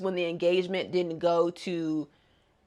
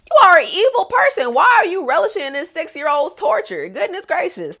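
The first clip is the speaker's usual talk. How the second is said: higher and louder, indignant; outraged; 10 semitones higher, 8 dB louder than usual. Real, not acted. Viewer scolding the cruel stepmother, higher and louder. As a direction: call her out, fully outraged.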